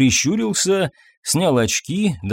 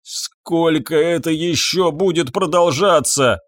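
slow, higher-pitched lament; trembling despair; 4 semitones higher, slower than usual. A narrator's reading. Husband asks when this will end, trembling. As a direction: draw out the question in despair. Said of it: "трясся Фабьен".

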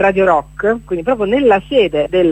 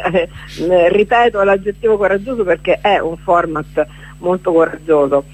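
usual speaking voice

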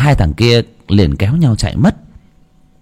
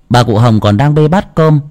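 usual speaking voice

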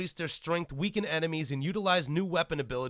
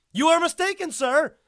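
high, loud scolding; mock stern; 12 semitones higher, 7 dB louder than usual. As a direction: snap it at them.

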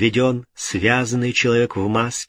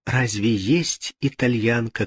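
ordinary delivery, nothing marked

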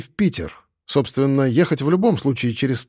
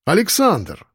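higher, aggrieved call; hurt exclamation; 4 semitones higher, slower than usual. A narrator's reading. Koshkin snubbed by Alexander, offended exclamation. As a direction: cry the name out in hurt. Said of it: "обиженно воскликнул тот".

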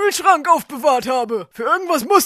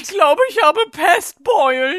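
escalating whine. Acted. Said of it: "Hör auf zu simulieren".

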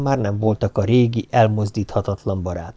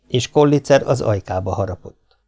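ordinary delivery, nothing marked

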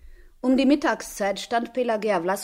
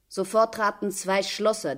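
ordinary delivery

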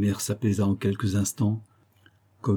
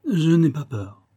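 raised, higher-pitched declaration; said out loud; 6 semitones higher, 4 dB louder than usual. A narrator's reading. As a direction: speak up defiantly. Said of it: "et à voix haute".

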